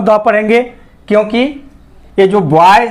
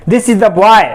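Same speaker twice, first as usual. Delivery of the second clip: put-on "this is the boy" pushed louder with a rise on "boy"; emphatic sentence; 3 semitones higher, 3 dB louder, much slower than usual. Shown as a demonstration. Teacher demonstrates the emphatic "the" to students. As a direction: lean on "this is the boy", lifting "boy". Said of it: "इस sentence पे हम जोर दे रहे हैं".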